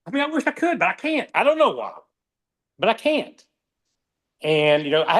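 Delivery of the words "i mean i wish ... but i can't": high, pleading frustration; helpless, voice climbing up; frustrated. Real, not acted. Frustrated admission, voice rising high.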